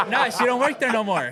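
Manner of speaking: high, loud, laughing through the words; a real laugh running through the line. Real, not acted. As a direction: burst out laughing as you say it. Laughing while denying it.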